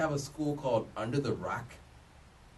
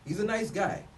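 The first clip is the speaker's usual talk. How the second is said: ordinary talk, nothing marked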